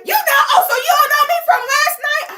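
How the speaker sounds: high, loud accusing cry; indignant woman's shout. Retold or imitated. Woman shouting at the man who denies knowing her, high and loud. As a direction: throw it at him like a scorned lover.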